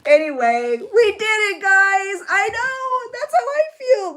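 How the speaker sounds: high, loud, overcome with tears; tearful wail. Real, not acted. Mom overcome by her daughter's song, tearful wail. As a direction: wail it through happy-sad tears. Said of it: "okay, so I'm crying".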